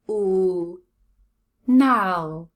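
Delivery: dark "l" held alone, then a loud "nal"; drawn-out, emphatic "nal"; isolated syllable sounds. Shown as a demonstration. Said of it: "Second syllable".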